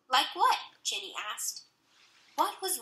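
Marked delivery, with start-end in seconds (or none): 0.1-0.6 put-on curious little girl. A narrator's reading.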